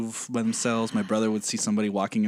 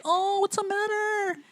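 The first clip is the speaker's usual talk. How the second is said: much higher, slow, sugary; mock sweet concern; much slower than usual. Mocking himself to his friends, high slow fake sympathy. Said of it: "And I'm like".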